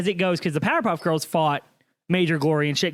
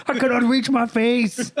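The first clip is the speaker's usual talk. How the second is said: slow, higher put-on strongman voice; Jorgen von Strangle; 5 semitones higher, slower than usual. Co-host joining muscleman bit, slow mock-helpless voice. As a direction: strain it out slowly as a helpless muscleman.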